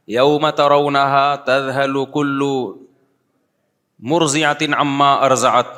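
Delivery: slow, drawn-out tajweed recitation; slow chanted Arabic verse; Quran recitation. Acted. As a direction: recite the verse slowly and melodically.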